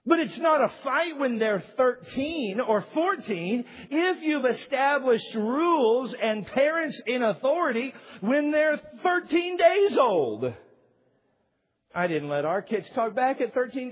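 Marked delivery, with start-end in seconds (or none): 0.0-10.5 raised, slow preaching build; slow preacher's emphasis on days. Acted. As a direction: drive the point home from the pulpit.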